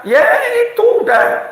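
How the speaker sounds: breaking into a laugh, slow and high; laughing reply. Acted. Employee laughing as he answers a coworker, slow and high.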